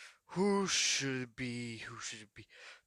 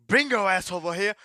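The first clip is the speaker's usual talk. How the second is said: mock shouted order; 4 semitones higher, 8 dB louder than usual. Villain orders the dolls.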